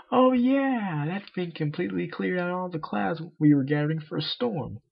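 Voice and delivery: a sporty pony losing patience, voiced high; high-pitched cartoon pony insisting it won't work; Rainbow Dash voice.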